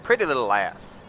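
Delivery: loud, hammed-up southern accent like a ranch hand; southern ranch talk. Gamer inviting viewers down south, loud mock southern accent. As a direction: lay on a big Southern accent, welcoming a partner. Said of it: "I could go all southern on you".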